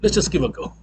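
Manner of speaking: ordinary delivery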